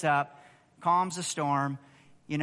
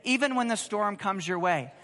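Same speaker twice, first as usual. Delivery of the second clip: ordinary talk, nothing marked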